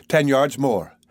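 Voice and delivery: usual speaking voice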